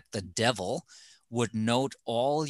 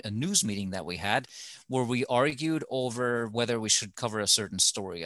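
ordinary talk

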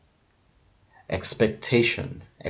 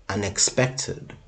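usual speaking voice

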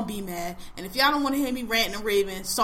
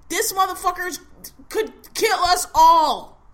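higher, louder and slow, hammering each word; alarmed shout; 7 semitones higher, 7 dB louder, slower than usual. Real, not acted. Warning friends the president could kill them, shouting. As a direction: sound the alarm with full force.

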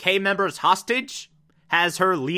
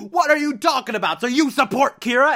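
higher, fast, indignant mock yell; 8 semitones higher, 3 dB louder than usual. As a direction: shout him down in disbelief. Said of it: "people are yelling at him".

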